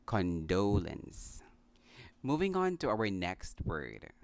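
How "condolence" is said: "condolence" as kun-DOH-luns; slow model "condolence", stress on the second syllable; correct pronunciation. Shown as a demonstration.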